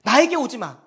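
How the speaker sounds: loud, sharp prohibition; stern rebuke. Retold or imitated. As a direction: scold as a disciplining father. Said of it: "엄청난 훈육이 있어요".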